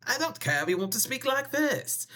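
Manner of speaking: high-pitched put-on voice; playful high funny voice; silly voice. Shown as a demonstration. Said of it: "weird yesterday with my voices".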